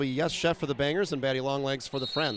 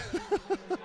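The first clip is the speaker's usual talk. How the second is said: short embarrassed laugh; 6 dB quieter than usual. Real, not acted. Laughs at own mistake.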